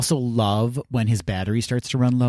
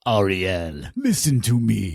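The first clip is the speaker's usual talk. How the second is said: put-on Caribbean crab accent, slowly; a stern little crab scolding; Sebastian voice; 2 semitones lower, slower than usual. Imitating Sebastian.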